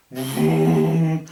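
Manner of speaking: slow drawn-out vroom for the torch; imitated torch noise. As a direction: stretch the vroom out like a big beam switching on. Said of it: "I just went".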